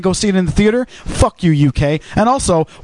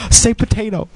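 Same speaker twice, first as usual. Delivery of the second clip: higher-pitched impression of coworkers; teasing coworkers; 4 semitones higher than usual. Coworkers asking for potato, imitated in a higher voice. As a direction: imitate coworkers egging someone on. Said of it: "all the girls I work with are always like".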